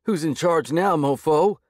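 high, loud manic taunt; crazed taunt. A narrator's reading. Thug aims pistol at the hero, manic and loud. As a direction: wave the gun and crow. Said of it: "he grinned crazily".

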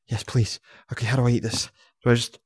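ordinary talk

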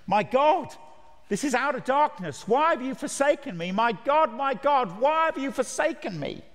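high, loud anguished cry; the preacher voices Jesus crying out from the cross, high and loud; cry of forsakenness. Acted. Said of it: "that terrible cry on the afternoon".

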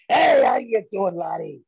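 loud, high Scottish shout; Scottish accent. Shown as a demonstration. Guest demoing a phone call for the host, Scottish accent. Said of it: "I'll do it in a Scottish accent".

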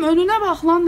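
loud, sharp cry of look ahead; warning the driver of the tractor, loud; yelled warning. Retold or imitated.

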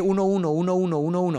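"uno" repeated in an even, unbroken pulse; steady run of "uno", each on the beat; counting the beat. Shown as a demonstration. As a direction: chant "uno" over and over like a metronome. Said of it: "y así, y me voy a ir".

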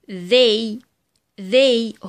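"they" opening on buzzing th; voiced TH. Shown as a demonstration. Teacher gives examples of ذ, "they" with voiced th. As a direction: model "they" twice with voiced th. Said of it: "وهننطقها ذا".

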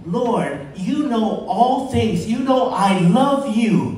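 high, loud, drawn-out avowal; fervent declaration. Acted. Peter answers Jesus' question, fervent declaration. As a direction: proclaim it slowly with rising force.

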